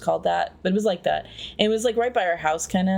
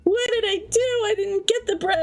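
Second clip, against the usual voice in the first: much higher, half-crying panic; high whiny wail; 13 semitones higher than usual. Retold or imitated. Boy walking home guilty about toy money, high whiny wail. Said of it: "I was like".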